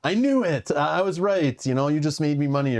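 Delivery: high mock-triumphant retelling; gloating triumph. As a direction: imitate someone crowing over a won bet. Acts out commenter winning a bet.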